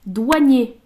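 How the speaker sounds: loud one-word reveal; card announcement. Real, not acted. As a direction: call out the card's name. Announcing each card drawn.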